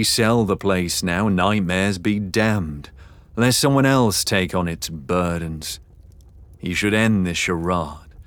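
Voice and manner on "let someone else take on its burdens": ordinary delivery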